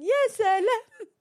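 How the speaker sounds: very high, loud, slow laughing "يا سلام"; mock "يا سلام" with a laugh; laughing sarcastic exclamation; sarcastic wow. Real, not acted. Mocking exclamation again.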